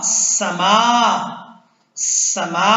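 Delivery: "समा" with short "स", long "मा"; stretched meem. Shown as a demonstration. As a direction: draw out the last vowel of "समा". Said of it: "मीम को खींचना है".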